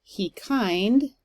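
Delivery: light "he", stressed "kind"; deliberate teaching stress on "kind"; iambic emphasis. Shown as a demonstration. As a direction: land the beat on "kind". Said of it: "read it with more emphasis".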